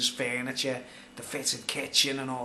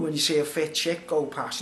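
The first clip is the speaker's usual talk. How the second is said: usual speaking voice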